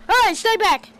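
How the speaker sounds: loud, high-pitched warning; warning shout. Real, not acted. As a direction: yell to keep them away. Fending off game enemies.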